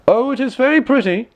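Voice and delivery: high, loud forced delight; emperor's falsely pleased exclamation; lightly faked praise. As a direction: gush over nothing to hide doubt. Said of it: "he said aloud".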